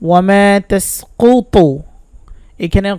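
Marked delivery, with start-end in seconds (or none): 0.1-1.5 slow, drawn-out tilawat; measured Quranic chant; Quran recitation. Acted. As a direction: chant it with long vowels. Recites verse fragment.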